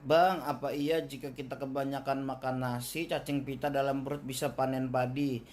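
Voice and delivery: a deep, flat voice for the asker; low voice reading a viewer question. Acted. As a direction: read the question low and dull. (suara rendah membacakan pertanyaan penonton)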